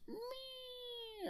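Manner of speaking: very high, soft robot bleep; droid beep. Retold or imitated. R2-D2 hit by a blaster, a tiny high electronic whine. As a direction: squeak like a damaged droid. R2-D2 gets shot.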